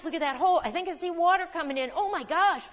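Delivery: high, fast, alarmed chatter; mock panic. Preacher acts out fearful self-talk in the storm, high and hurried. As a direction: rattle it off as if water is pouring in.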